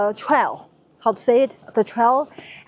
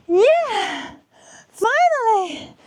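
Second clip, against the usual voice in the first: high triumphant cry at the top; excited cheer; 11 semitones higher, slower than usual. Real, not acted. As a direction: let it burst out in relief, high. Reaches top of the wall.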